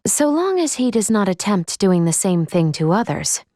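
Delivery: ordinary talk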